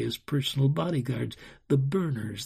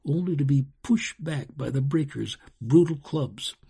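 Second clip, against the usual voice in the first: ordinary delivery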